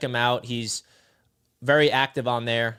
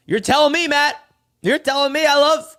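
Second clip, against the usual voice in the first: higher and louder, bursting with agreement; excited agreement; 11 semitones higher, 6 dB louder than usual. Real, not acted. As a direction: shout it out like you totally agree.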